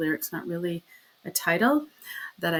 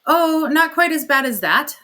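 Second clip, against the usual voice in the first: forced reply; 8 semitones higher, 9 dB louder than usual. Acted. Miss Meadows answers tensely.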